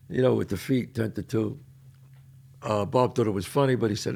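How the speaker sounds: ordinary talk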